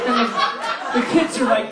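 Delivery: usual speaking voice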